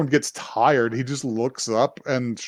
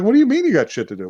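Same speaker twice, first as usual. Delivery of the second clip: fast, higher, hammed-up outrage; mock indignant rush; 8 semitones higher, much faster than usual. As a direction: rattle it off in pretend disbelief. Princess indignant, retold.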